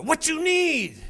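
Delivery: put-on high, loud Indian accent, curt; rude attendant. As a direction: drop in the attendant's snappy line.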